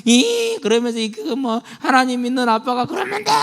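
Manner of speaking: hammed-up complaining kid; imitating a sulky child protesting; sulking child. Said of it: "울며 불며 삐지며".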